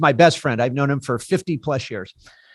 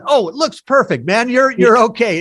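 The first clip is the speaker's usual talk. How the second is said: mock reassurance; 6 semitones higher, 3 dB louder than usual. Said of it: "he's just screwing with me".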